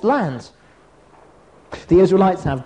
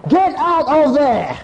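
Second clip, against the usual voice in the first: high, drawn-out mock yell of alarm; mock scream; 6 semitones higher, 3 dB louder than usual. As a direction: cry out slowly, like raw instinct sounding a warning. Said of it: "they were screaming".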